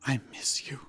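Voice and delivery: soft unvoiced whisper. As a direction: breathe it out as a whisper. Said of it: "he whispered".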